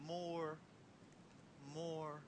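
very soft, measured single word; gentle teaching voice. Retold or imitated.

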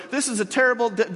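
scared elves. Retold or imitated. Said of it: "They're like".

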